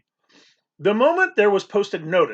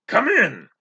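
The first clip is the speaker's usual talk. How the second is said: gruff captain; 6 semitones higher, 3 dB louder than usual. A narrator's reading. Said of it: "in a voice like the roar of a cannon".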